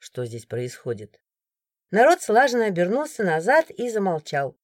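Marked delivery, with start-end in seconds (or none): 0.0-1.2 much lower, softer, slow commanding voice; quiet authority that silences the crowd; calm confident guard. A narrator's reading. A guard arriving and questioning the crowd, low calm authority. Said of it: "уверенный, спокойный голос".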